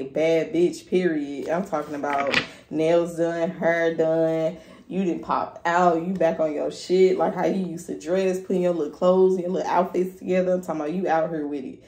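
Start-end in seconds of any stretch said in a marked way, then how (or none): none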